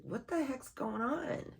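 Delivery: high, drawn-out bewildered question; mock bewilderment. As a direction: act baffled, stretching it out high. Voices bewildered reaction to energies.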